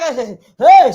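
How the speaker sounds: loud exclaim. Acted. Exclaiming about Jemima.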